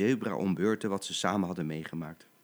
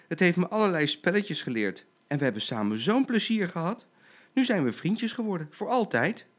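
higher-pitched happy reporting; eager child-like lion cub; excited cub; 6 semitones higher than usual. Acted. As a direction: gush to mother about a new friend. Cub tells mother happy news.

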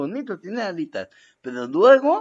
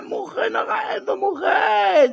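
high, frightened pleading cry; a husband pleading with his wife, high and panicked; panicked plea. Retold or imitated. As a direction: beg her to stop, terrified and shrill. Begging his wife with broom.

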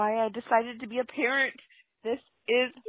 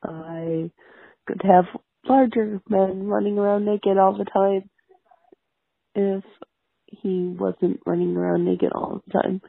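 usual speaking voice

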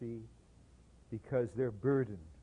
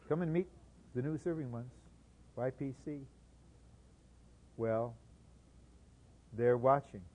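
usual speaking voice

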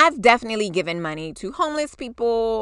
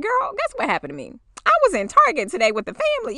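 much higher, fast eager news; gossipy excitement; 10 semitones higher, faster than usual. Retold or imitated. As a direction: call your friend to tell all. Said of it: "you're like".

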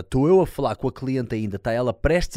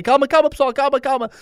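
loud, much higher shouted pleas for calm; shouting calm down; 8 dB louder than usual. Retold or imitated. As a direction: yell at everyone to stay calm. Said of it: "estou aos gritos". (a gritar calma ao pessoal)

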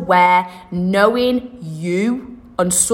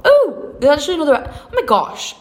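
surprised; 7 semitones higher than usual. Real, not acted. Sudden idea mid-podcast.

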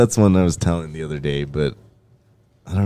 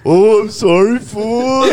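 higher and louder, breezy offhand sorry; casual mock apology; 14 semitones higher, 7 dB louder, slower than usual. Hector apologizes to Fernie while still peeing, an offhand mock apology. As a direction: toss off a careless apology while laughing. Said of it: "He's like".

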